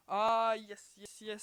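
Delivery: loud, relieved burst of success; real gamer's victory cry; triumphant cheer. Real, not acted. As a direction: cheer out loud as the jump finally works. Finally lands the jump after failures.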